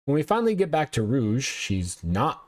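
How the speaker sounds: normal talking voice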